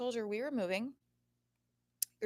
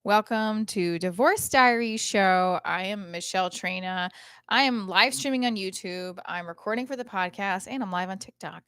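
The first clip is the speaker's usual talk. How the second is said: show intro; 10 dB louder than usual. Real, not acted. Host welcomes livestream and podcast listeners, loud show intro. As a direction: launch the show at full volume.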